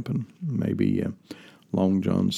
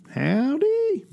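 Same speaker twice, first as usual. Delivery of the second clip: high, whooping country howdy; a big squealing country hello; Minnie Pearl greeting. Retold or imitated. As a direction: holler it like the Opry comedienne. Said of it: "Remember Cousin Minnie Pearl".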